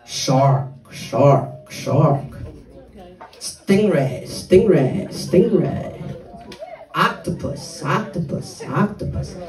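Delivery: low, repetitive chant of single words; same low tune on every repeat. Retold or imitated. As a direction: impersonate a brother repeating each name. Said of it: "my brother goes like this".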